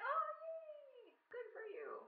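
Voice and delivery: high, sarcastic yay; a falsely chirpy congratulation; mock cheer. Mocking a rival queen with fake cheer.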